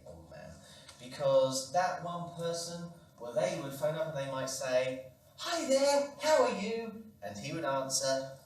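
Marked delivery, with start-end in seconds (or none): 5.4-6.9 higher, louder friendly greeting; cheerful friend. Acted. Friend phoning the old man, high and cheerful. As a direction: greet him brightly and high.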